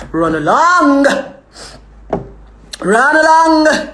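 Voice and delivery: high, loud, stretched-out call. Real, not acted. Streamer hurrying viewers along, drawn-out loud call. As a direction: sing out the last word, long and high. Calling out a drawn-out sign-off phrase.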